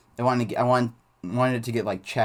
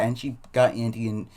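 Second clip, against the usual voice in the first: ordinary delivery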